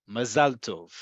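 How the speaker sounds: "Mazal Tov" stressed ma-ZAL TOV; fixed emphasis. Shown as a demonstration. Teacher shows the accent does not change, "Mazal Tov" as ma-ZAL TOV. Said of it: "versus the emphasis".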